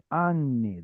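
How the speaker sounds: "ani" as AH-nee with a full first vowel; stress on first syllable. Shown as a demonstration. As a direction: lean on the "a" of "ani". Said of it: "There's the stress".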